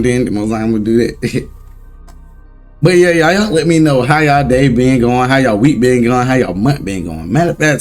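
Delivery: ordinary talk, nothing marked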